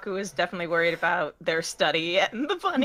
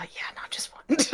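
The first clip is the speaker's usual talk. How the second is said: whispered aside; 6 semitones higher, 6 dB quieter than usual. Acted. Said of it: "Buck's aside".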